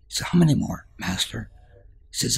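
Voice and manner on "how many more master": higher, louder pressing question; eager asking. Acted. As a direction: push for the number. Young man presses master.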